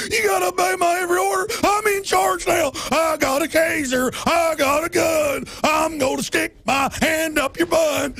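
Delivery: high, shouting power-mad cop; ranting cop impression. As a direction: bellow orders like a tyrant cop. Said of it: "That's what they do".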